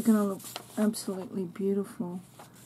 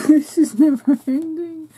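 loud, high laughing exclaim; gleeful laughing outburst; laughing delight; 6 semitones higher, 12 dB louder than usual. Real, not acted. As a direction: laugh through the words, overwhelmed. Overwhelmed by more gifts.

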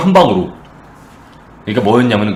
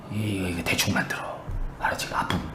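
very soft, low aside; imitated hushed backroom instruction; conspiratorial; 6 semitones lower, 15 dB quieter than usual. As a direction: drop right down as if passing a secret order. Secret bribe to the helmet maker.

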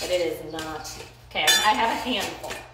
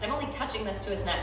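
usual speaking voice

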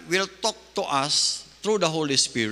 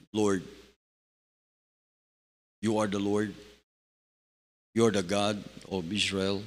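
lower, soft, devout prayer voice; hushed prayer; 9 semitones lower, 4 dB quieter than usual. Pretend. Preacher acts a believer praying aloud to God, lower and hushed. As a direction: pray quietly and humbly to the Lord.

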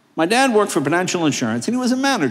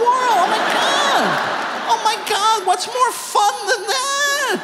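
gleeful; slower than usual. Retold or imitated. Child's delight recalled.

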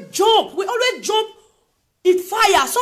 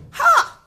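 high sudden ha of disbelief; one sharp bitter laugh; 9 semitones higher, 3 dB louder than usual. Real, not acted. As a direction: bark out a scornful ha. Bitter exclamation at news.

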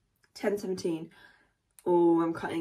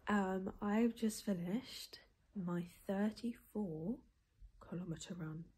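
whispering; 8 dB quieter than usual. Real, not acted. Whispering morning vlog.

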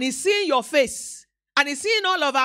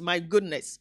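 normal talking voice

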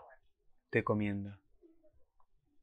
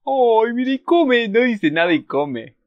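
high, slow cooing at a cute character; 11 semitones higher, 5 dB louder, slower than usual. Real, not acted. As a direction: coo like watching a pet eat.

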